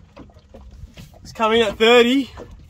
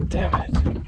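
breathy under-the-breath damn it; real unvoiced frustration; frustrated curse; 6 dB quieter than usual. Real, not acted. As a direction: mutter it, disappointed by the ray. Hooked a ray instead of fish.

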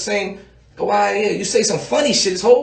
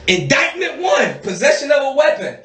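pitched-up mock indictment call; mock court announcement; 7 semitones higher than usual. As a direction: announce the charge like a judge.

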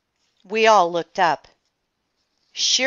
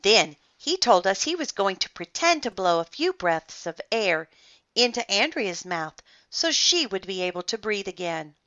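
plain reading voice

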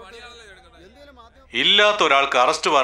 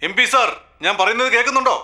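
higher-pitched, pressing questioning; raised pitch, demanding an answer; insistent calling out; 7 semitones higher than usual. Acted. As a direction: press someone who pretends not to hear. Anchor presses unresponsive MP to answer.

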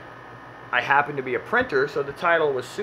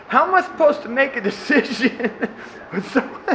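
put-on much higher, helpless little kid voice, laughing; whiny kid; 8 semitones higher than usual. Eight-year-old kid complaining to his mom, whiny and laughing. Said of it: "he goes".

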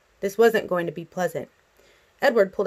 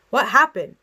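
raised, louder demanding question; yelling; 4 semitones higher, 6 dB louder than usual. A narrator's reading. Father chasing daughter downstairs, yelling. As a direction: yell the question with moderate force. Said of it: "he yelled".

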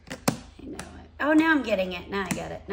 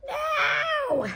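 sudden shrill No of dismay; high dismayed cry; 8 dB louder than usual. Real, not acted. Discovers a missing piece.

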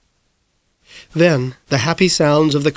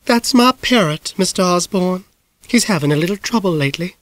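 lightly raised, apologetic lady; a hint of an embarrassed hostess; Miss Blueglass voice; 5 semitones higher than usual. A narrator's reading. Miss Blueglass explaining her parrot to Mister Osborne, lightly voiced.

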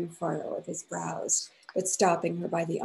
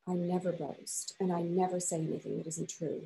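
soft; 7 dB quieter than usual. A narrator's reading. Husband answering his wife softly, holding back anger. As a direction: hold the anger down, speak softly. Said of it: "he said softly".

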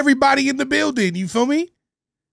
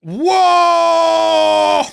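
high loud startled whoa; shocked whoa; 6 semitones higher, 5 dB louder than usual. Real, not acted. Reactor caught off guard by the scene, loud startled cry. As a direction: jolt back with a loud whoa.

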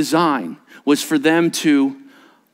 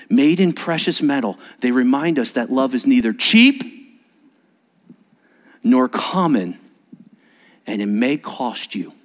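ordinary talk, nothing marked

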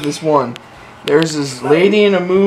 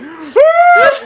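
high sudden scream; startled scream; 6 dB louder than usual. Shown as a demonstration. Imagining her reaction to shocking news, screaming. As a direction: let out a sharp shocked scream. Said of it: "if you all of a sudden hear it".

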